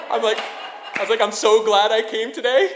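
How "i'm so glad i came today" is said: high, loud, thrilled exclamation; giddy onlooker, loud and high; gleeful excitement. Retold or imitated. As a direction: burst out with delight at the drama. Retold glee at watching a quarrel.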